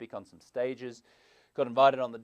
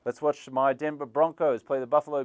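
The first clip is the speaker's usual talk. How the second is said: mock bro cheer; 4 semitones higher, 8 dB louder than usual. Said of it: "a bud with the boys".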